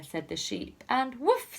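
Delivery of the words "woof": woofing dog. Acted. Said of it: "said the dog".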